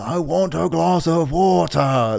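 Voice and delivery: put-on high, slow shouting old man; imitating old man. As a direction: call out slowly like a confused old man. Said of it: "the impression of you going".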